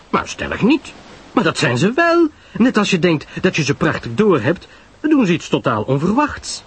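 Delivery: high, emphatic chatter; Pamela Lyle, arguing. Acted. Pamela contradicts Poirot about human nature, high and emphatic. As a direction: insist in a high, lively voice.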